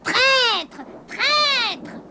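high, drawn-out witch's cry of rage; enraged howl. A narrator's reading. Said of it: "hurla de rage".

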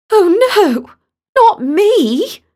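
high, loud panicked wail; panicked cry. Acted. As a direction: cry out in dismay at being picked. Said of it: "and panicked".

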